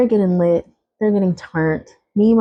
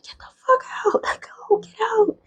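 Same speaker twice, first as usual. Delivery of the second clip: strained whisper-yell; 4 dB quieter than usual. Retold or imitated. Shaking, ordering sisters out.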